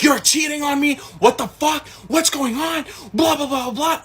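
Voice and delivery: high-pitched imitation of an outraged girlfriend; mock furious tirade. Girlfriend accusing him of cheating, imitated high-pitched fury. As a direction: rant in a squealed put-on voice, dissolving into blahs.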